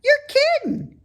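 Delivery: high, loud piggy voice; a young pig, eager and impressed; bright squeaky pig voice; Wilbur the pig. A narrator's reading. Wilbur amazed.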